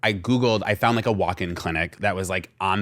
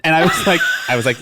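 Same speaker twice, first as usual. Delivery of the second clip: usual speaking voice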